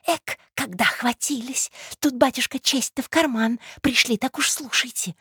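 high, scolding undertone; mock his late scruples; scornful conspiratorial woman.

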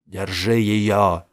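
loud, slow barked order; shouted order. A narrator's reading. Jamil shouting to the janitor, loud and slow. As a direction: order the janitor to grab her. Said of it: "крикнул Джамил уборщику".

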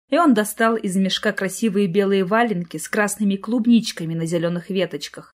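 plain reading voice

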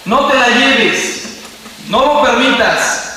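mock cry begging God, not full volume; grito desesperado imitado. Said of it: "se pone a gritar". (súplica desesperada, aguda y alargada)

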